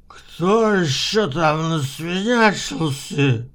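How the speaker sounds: put-on slow slurred drunken voice; drunk coach. A narrator's reading. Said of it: "такой же пьяный".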